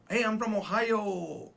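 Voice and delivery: mock Ohio native. Teacher mocking a wrong greeting, hammed-up Ohio native. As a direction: stretch it out like a hokey Ohioan. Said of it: "You know, you don't do that".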